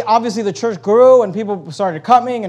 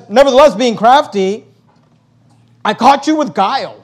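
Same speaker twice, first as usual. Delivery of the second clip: mock admission, raised and emphatic; sarcastic; 4 semitones higher, 6 dB louder than usual. Apostle to his accusers, sarcastic. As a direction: read it as a pointed, sarcastic confession. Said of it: "he's just being sarcastic".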